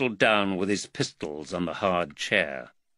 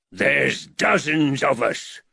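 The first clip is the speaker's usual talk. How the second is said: deep growl; 4 semitones higher, 4 dB louder, slower than usual. A narrator's reading. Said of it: "growled a deep voice".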